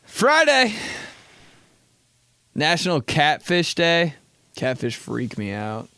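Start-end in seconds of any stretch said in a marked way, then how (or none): 0.0-4.1 1 announcer. Acted. Announcing the day's holiday.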